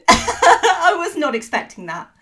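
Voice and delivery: laughing through the words; cracking up mid-sentence. Real, not acted. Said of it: "I think I've broken him".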